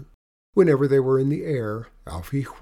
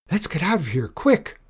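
high, urgent shout to flee; 6 semitones higher, 3 dB louder than usual. A narrator's reading. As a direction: build to a high, loud last word. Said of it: "Alfie shouted".

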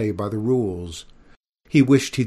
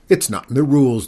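loud, raised objection; insistent dragon; 4 semitones higher, 9 dB louder, faster than usual. A narrator's reading. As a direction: insist on it forcefully. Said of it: "the dragon persisted".

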